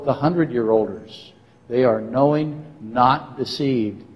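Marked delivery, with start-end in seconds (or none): none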